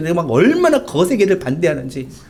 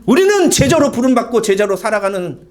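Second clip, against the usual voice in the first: brisk raised-voice drill answer; military style recitation; 6 semitones higher than usual. Shown as a demonstration. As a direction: rattle it off like a soldier reporting. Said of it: "관등성명처럼".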